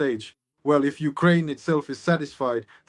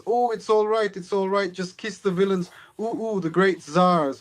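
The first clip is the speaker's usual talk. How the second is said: put-on higher, coaxing old granny voice; kind old grandmother; 5 semitones higher than usual. Old grandmother urging Grinev to kiss the hand, higher coaxing voice. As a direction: soothe him like a fretting granny, pitched up. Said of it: "She says".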